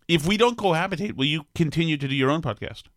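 ordinary talk

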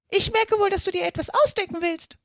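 high-pitched, accusing bird voice; suspicious pigeon. A narrator's reading. Pigeon suspecting Alice invents a story, suspicious. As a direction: catch a liar out, in pigeon voice.

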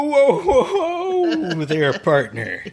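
high, laughing cowboy-style whoa; a playful giggling whoa there; laughing whoa partner. Retold or imitated. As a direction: rein in the kid through laughter. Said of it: "And I was like".

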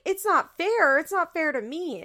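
high complaining echo; a petulant mimicking whine; mock whiny protest. Barbara protesting back at Janelle, high mock whine. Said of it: "And Barbara's like".

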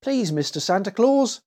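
put-on high, shy little boy; bashful child addressing Santa. A narrator's reading. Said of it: "said Willie shyly".

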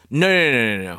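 loud, high rush of nos; quick correction. Real, not acted. Guest correcting host about custard, loud. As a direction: wave off the mistake quickly.

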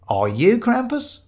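high, slow interrogation; pointed questioning. Acted. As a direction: stretch out the question, pitched high. Shiloh confronts the startled man.

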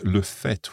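"le fait" with a clearly sounded final T; final T pronounced. Shown as a demonstration. Teacher showing the noun to learners, "fait" ending in an audible T. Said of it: "then we pronounce that T".

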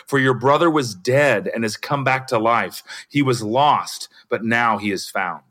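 measured storyteller's reading of a parable; Bible story reading. Acted. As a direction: read the parable steadily to the group. S0 reads parable aloud.

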